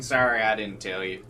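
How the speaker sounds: ordinary delivery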